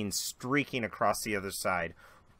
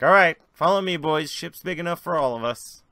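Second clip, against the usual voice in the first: high, loud, cheerful call to the crew; rally the boys aboard; hearty captain; 6 semitones higher, 10 dB louder than usual. Acted. Mick inviting everyone aboard the ship, loud and hearty. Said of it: "he's like".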